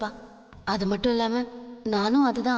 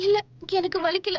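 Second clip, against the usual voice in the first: tearful denial, pitched up; crying; 7 semitones higher than usual. Acted. Girl denying her pain while crying. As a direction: choke out that it doesn't hurt. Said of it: "எதுக்காக அழற".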